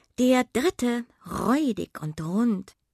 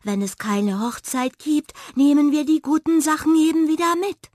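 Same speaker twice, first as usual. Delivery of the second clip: hare voice; 9 semitones higher, 6 dB louder than usual. A narrator's reading. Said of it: "meinte der Hase".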